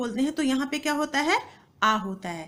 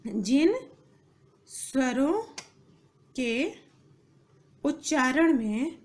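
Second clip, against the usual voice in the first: slow, with long pauses between words; word-by-word dictation; slower than usual. Shown as a demonstration. Teacher dictating definition to students, slow and spaced out. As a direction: dictate it one word at a time.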